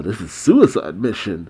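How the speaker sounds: ordinary delivery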